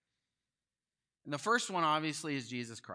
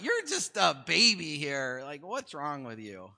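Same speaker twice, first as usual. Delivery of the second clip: teasing wife; 3 semitones higher, 7 dB louder, slower than usual. Retold or imitated. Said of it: "She's like".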